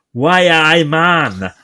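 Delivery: a slow, hammed-up Geordie "Why, I man"; bad Geordie accent. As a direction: mimic a Spanish waiter's clumsy Geordie greeting. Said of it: "the worst Geordie accent ever".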